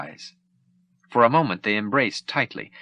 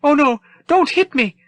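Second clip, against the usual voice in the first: very high, loud pleading robot voice; begging droid; 7 dB louder than usual. Acted. The droid begs the troopers not to hit him, high and frantic. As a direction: beg like a fussy droid afraid of blows. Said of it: "the droid begged".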